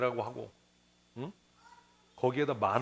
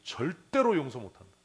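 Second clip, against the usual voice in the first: louder, stubborn refusal; mock vow; 4 semitones higher, 7 dB louder than usual. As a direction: declare you will never forgive. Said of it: "입으로도 얘기해요". (고집스럽게 큰소리로 거부하듯)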